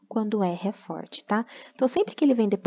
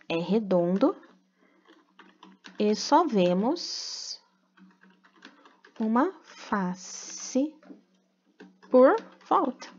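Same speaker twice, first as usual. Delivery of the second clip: very slow, with long pauses between phrases; slow dictation; much slower than usual. Real, not acted. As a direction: dictate slowly for pupils writing along. Dictating the written answer slowly.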